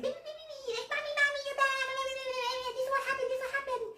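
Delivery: very high chirpy bird voice; bird voice talking. Pretend. Bird greeting its mommy in a very high chirpy voice. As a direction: talk like a happy little bird.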